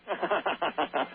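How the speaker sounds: staccato put-on chuckle; mock sitcom-creature laugh; character laugh. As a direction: rattle off a rapid ha-ha-ha in character. Plays Alf's laugh in impression.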